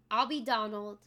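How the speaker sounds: ordinary delivery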